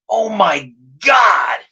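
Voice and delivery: long drawn-out appalled cry; horrified outcry. Retold or imitated. Storyteller watching the mess squirt everywhere, slow appalled cry. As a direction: stretch it out in mock horror. Acting out horrified reaction to explosion.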